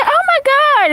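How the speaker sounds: high shocked "oh my God"; mock shocked gasp. Retelling the pharmacist's shocked reaction, high and gasping.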